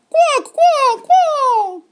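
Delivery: piercing, very high loud quacking; chicken squawks. A narrator's reading. Chicken pirates falling for Henry's trap, squawking. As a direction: squawk like a panicked hen.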